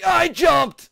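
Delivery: high, loud protest; indignant shout. Real, not acted. Player protesting a failed jump, indignant shout. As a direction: yell it in disbelief.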